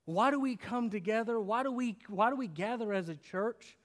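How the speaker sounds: ordinary talk, nothing marked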